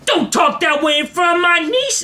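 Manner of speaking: high mimicked outburst; scolding bus passenger. Man scolds passenger for imagined niece, high holler. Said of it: "And he goes".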